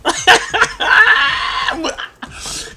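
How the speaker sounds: high, breathless cackle; helpless laughter. Real, not acted. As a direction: crack up long and high at your own joke. Cracking up at his own imitation.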